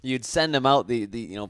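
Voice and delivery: ordinary talk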